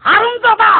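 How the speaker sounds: high, loud burst of fury; outraged yell. Acted. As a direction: explode at the pupil. Learns food came from dog's mouth.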